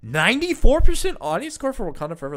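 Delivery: high, loud disbelief at the number; surprised. Real, not acted. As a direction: blurt it out, shocked. Surprised at high audience score.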